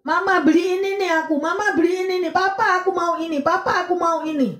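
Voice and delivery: put-on high-pitched pestering kid voice; nagging child. Child nagging mama and papa to buy things, whiny begging. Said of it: "Anak ini terus ganggu orang tuanya".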